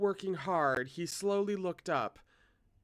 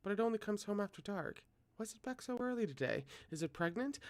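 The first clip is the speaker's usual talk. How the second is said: mother muttering; 6 semitones higher, 4 dB quieter, faster than usual. A narrator's reading. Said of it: "his mother muttered".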